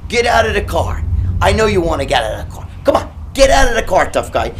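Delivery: loud, goading yell with a gun; taunting shout. Retold or imitated. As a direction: dare him to get out. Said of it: "he pulls out a gun".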